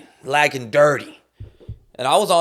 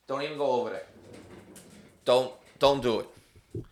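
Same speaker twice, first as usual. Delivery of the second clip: warning the dog; 3 dB quieter than usual. Real, not acted.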